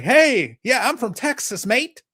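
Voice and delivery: high, stretched mock Antipodean accent; mock New Zealander. Host mocking foreigners pretending to be American, mock New Zealand accent. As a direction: imitate a New Zealander or Australian posing as local. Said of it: "find somebody from New Zealand. They're like".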